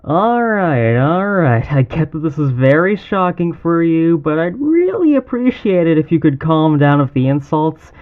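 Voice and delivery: loud and firm, talking over the captive's yelling. Acted. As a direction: raise the voice to cut through the insults. Calming a shouting captive.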